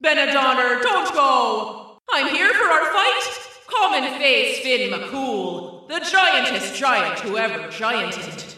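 booming giant call into a drum; bellowing. Acted. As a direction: bellow to make him flee. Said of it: "bellowing into the bottom of it".

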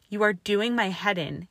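loud angry roar; a furious roar at the noise. A narrator's reading. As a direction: roar it at her in fury. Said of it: "he roared".

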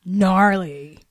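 lightly mocked valley-dude accent; a quick jab in a California surfer accent. Co-host parodying the rapper, California valley accent. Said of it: "South Beach, California valley ass accent".

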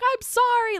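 high, loud panicked sorry; frightened apology. Retold or imitated. Lex answering her brother in the dark car, a high panicked cry. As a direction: blurt it out in terror.

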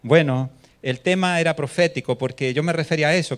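ordinary talk

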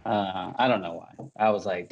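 ordinary talk

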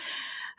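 long, breathy, worn-out sigh; weary sigh. Retold or imitated. Tired client sighing mid-complaint. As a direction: let out a drained sigh.

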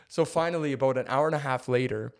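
ordinary delivery

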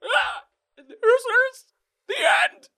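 very high, loud strangled Mickey cries; dying choking squeal. Acted. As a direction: choke out the last words.

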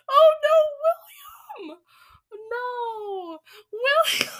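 high mock cries of horror for William. Reader shrieking at the witch kicking William.

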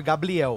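"Gabriel" with the r said as l, "Gabliel"; tongue-tie lisp. Pretend. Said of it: "da língua presa".

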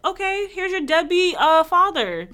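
high, sarcastic sweet talk; mocking sass. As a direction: taunt her sweetly, like talking down. Mocking [name]'s persona.

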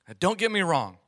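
usual speaking voice

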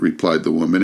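ordinary delivery

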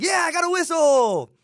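high, slow, excited yell; excited shout. Retold or imitated. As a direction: cheer about getting a whistle. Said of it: "everybody, like, is like".

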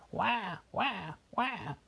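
put-on high wailing baby cry; high baby wah wah wah. A narrator's reading. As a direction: wail like a crying toddler. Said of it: "started to cry".

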